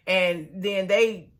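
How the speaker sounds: normal talking voice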